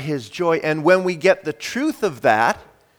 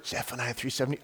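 soft, lowered hush; little whisper; 4 semitones lower, 14 dB quieter, much faster than usual. Acted. God nudging the preacher during a song, soft whisper. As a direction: drop to a small, secret voice. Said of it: "Hear the little whisper".

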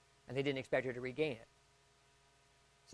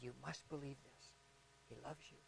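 much softer, near-whispered assurance; hushed bedside voice; 3 semitones lower, 22 dB quieter than usual. Retold or imitated. Pastor to a dying woman, hushed and soft. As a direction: murmur it close to her ear.

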